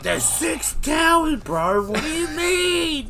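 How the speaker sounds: high, drawn-out incredulous wail; mock exasperated yelp; retold exasperation. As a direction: stretch it out, acting out exasperation at a mate. Acted exasperated shout over the phone.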